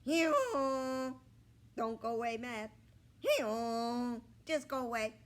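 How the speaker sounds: loud, high donkey bray through the words. A narrator's reading. Donkey Romeo brays mockingly at Ernest. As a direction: tease him like a braying donkey.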